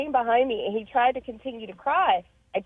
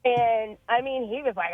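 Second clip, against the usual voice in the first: usual speaking voice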